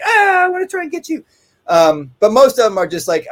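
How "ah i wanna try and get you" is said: much higher put-on dog voice, straining to get the cat; a high, excited dog's voice; eager dog voice. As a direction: voice the barking dog, high and keen. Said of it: "some of the dogs are like".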